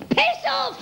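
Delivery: quick, high-pitched Cockney comeback; chirpy retort. Retold or imitated. Mum answering a passing cyclist, chirpy high retort.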